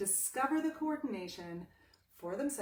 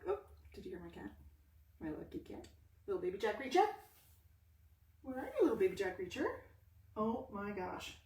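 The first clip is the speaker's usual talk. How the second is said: cooing to the cat; 3 dB quieter, faster than usual. Real, not acted. Talking to her cat in soft baby talk. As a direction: coo sweetly at the little cat.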